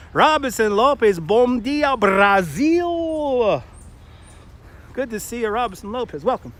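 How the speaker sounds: loud, high called-out greeting; high-energy announcer-like welcome, last word stretched; exuberant shout-out. Real, not acted. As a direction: holler a big hello to a faraway viewer. Calling out a greeting to a viewer.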